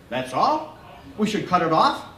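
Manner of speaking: a hint of the brusque doctor. A narrator's reading. Doctor waving off the boy, lightly gruff. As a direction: brush it off, gruff and quick. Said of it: "talks fast and funny".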